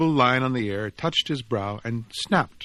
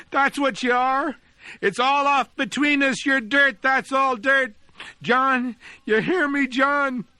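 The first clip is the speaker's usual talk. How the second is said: put-on high, loud, raging boy's shouting; boy yelling at his departed friend in anger; furious shouting; 13 semitones higher, 4 dB louder than usual. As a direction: spit every word at the betrayer across the street. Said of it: "he cried".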